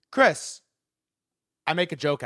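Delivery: usual speaking voice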